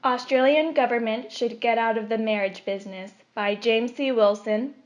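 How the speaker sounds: usual speaking voice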